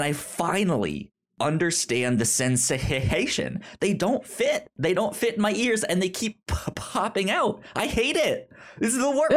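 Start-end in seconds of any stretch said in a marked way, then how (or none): 3.8-9.4 exasperated complaint. Real, not acted. Complaining about earbuds not fitting.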